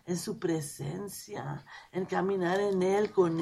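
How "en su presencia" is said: whispered. Real, not acted. Hushed worshipful stress.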